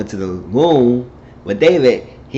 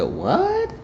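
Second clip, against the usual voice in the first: very high rising squeal; incredulous, sharply rising pitch; shocked disbelief; 3 dB quieter than usual. Acted. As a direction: squeak it out as an astonished question. Doctor reacting to shocking news.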